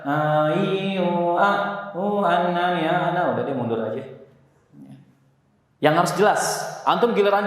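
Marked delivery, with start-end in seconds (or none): 0.0-3.4 sloppy a i u sung without full vowels; mumbled half-open vowels. Shown as a demonstration. Teacher showing students the wrong way, slack mumbled vowels. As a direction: let the vowels collapse lazily. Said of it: "Nggak boleh nanti misalkan".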